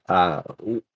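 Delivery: normal talking voice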